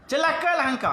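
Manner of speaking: high, sharp cry of woe to you; a stern rebuke to a rude bedouin. Acted. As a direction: rebuke him with force. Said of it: "jangan terkejut".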